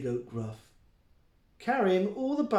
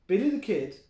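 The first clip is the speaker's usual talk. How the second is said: ordinary delivery, nothing marked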